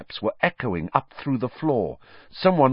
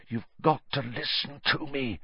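soft but higher, urgent plea; note of urgency; 4 semitones higher, 6 dB quieter than usual. A narrator's reading. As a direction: press him to listen without raising the volume. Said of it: "a note of urgency in his voice".

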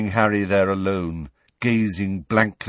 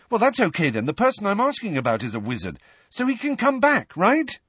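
higher, fast hopeful voice; eager relief; 11 semitones higher than usual. Acted. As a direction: rush on with hope.

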